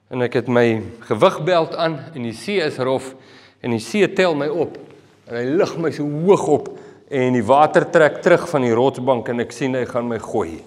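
ordinary delivery

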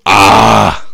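loud growly "Ah!"; Technoblade impression. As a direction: yell it like Technoblade. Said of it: "I'm Technoblade".

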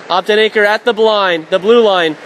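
ordinary talk

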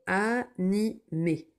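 Animé drawn out very slowly; slow dictation. Shown as a demonstration. Teacher dictating Animé very slowly. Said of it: "dictation read slowly".

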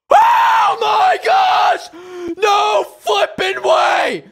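very high, drawn-out victory scream; overexcited screaming. Real, not acted. Player finally beating the hardest level, overexcited screaming. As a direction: scream it slowly in disbelief, loud and high. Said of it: "I shouldn't get too excited".

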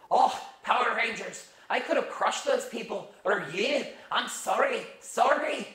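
put-on high apologetic Scottish accent; fretful robot with a Scottish accent; Alpha in a Scottish accent. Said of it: "lines I read as Alpha ... Scottish accent".

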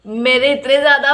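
ordinary delivery

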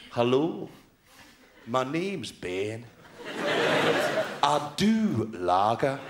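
slow, low Scottish Renton impression; Scottish accent. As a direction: play it dead hard and dry, Scottish style. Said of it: "Bit of Irving Walsh".

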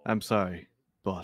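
low, subdued apology; grudging apology. Acted. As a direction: give in with a dropped, softened voice. Made to apologise to the boss.

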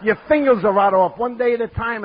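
loud, high-pitched taunt; mock jeering. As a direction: call it out like a mocking heckler. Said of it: "and they're all laughing".

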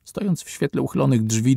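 usual speaking voice

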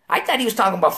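usual speaking voice

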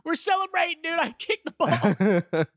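put-on high, slow, laughing kid voice; toddler celebrating. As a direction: play a proud three-year-old who scored. Imitating toddler after scoring.

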